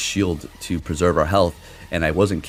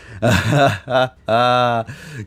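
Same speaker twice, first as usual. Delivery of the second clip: amused laugh; 6 semitones higher, 3 dB louder than usual. Real, not acted. Laughs at opponent's shield.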